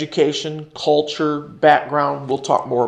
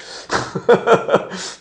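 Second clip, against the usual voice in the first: short burst of laughter; 6 semitones higher than usual. Real, not acted. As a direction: break into a quick laugh. Laughs after admitting his own anger.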